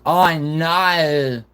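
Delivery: high, hammed-up Australian "oh no"; mock Australian wail; Australian accent. Shown as a demonstration. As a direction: pitch it up in broad Australian. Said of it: "in an Australian accent".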